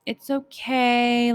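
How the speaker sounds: slow, half-hearted it's okay; unenthused. Retold or imitated. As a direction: shrug it off without enthusiasm. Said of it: "now they're kinda like".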